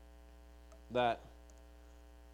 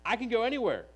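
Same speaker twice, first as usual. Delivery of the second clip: Peter's promise, much higher and louder, pleading; eager Peter; 6 semitones higher, 3 dB louder than usual. Acted. Peter vows to Jesus he'll follow anywhere, high and fervent. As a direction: Peter insisting, all fervour, higher pitched. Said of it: "Peter right here saying".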